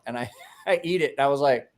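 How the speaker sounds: ordinary talk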